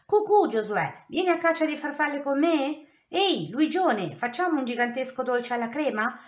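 playful wolf full of energy; cheerful and playful. A narrator's reading. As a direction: call out to friends full of fun. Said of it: "scherzava con il cuore leggero".

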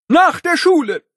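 high shouted, NACH punched; strict teacher. Acted. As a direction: yell it like a furious principal. Principal yells detention.

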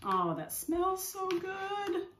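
slow, high, dreamy delight; savoring. Real, not acted. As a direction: breathe it out, savoring. Smelling the salad.

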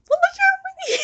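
very high, loud laughing shout; shrieking laugh. Real, not acted. Gamer spotted by another player, shrieking through laughter. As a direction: shriek with laughter that he was seen.

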